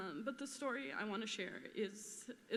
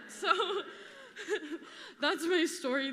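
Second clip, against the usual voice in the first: laughing; 5 semitones higher, 7 dB louder than usual. Real, not acted. Laughs mid-speech at memorial.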